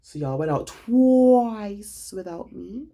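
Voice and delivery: high, slow indignation with a long drawn-out "twice"; indignant disbelief. Real, not acted. Calling out friends who went out without her, indignant disbelief.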